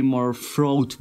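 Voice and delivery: normal talking voice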